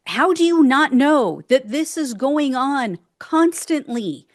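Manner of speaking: ordinary talk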